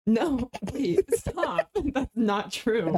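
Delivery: laughing through the words; giggling, begging them to stop; laughing protest. Real, not acted. Protesting a joke about her.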